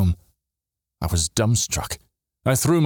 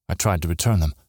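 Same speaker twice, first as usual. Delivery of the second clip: ordinary delivery, nothing marked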